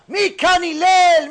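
high, loud, stretched-out holler; screaming caller. Retold or imitated. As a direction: burst in yelling for him slowly. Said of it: "He's screaming like he never saw him before".

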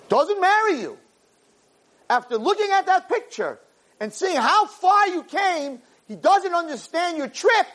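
high, slow, soaring plea; impassioned preaching. Acted. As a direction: lift it up, slow and pleading. Passionate preaching climax.

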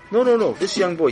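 high and loud eager no, no, no; king corrects the proud parents eagerly; eager correction. Acted. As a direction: rush to correct them brightly.

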